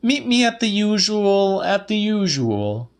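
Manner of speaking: high Celia, repeating herself; Celia's voice. A narrator's reading. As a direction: lift way up for Celia. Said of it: "she said".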